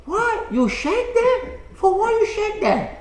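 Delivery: put-on very high, loud, incredulous Chinese-accented caricature; mock Chinese accent. Playing the incredulous Chinese customer.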